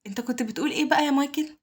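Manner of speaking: high, cheerful question; pleased. A narrator's reading. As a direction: ask it gleefully, pitch well up. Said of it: "قال له غايل وهو مبسوط".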